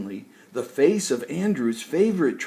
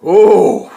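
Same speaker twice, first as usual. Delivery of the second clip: loud shaking laugh; quaking tree laugh; 6 semitones higher, 13 dB louder than usual. Acted. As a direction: let the laugh quake through the trunk. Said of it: "quaked the tree".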